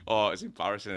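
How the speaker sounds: ordinary talk, nothing marked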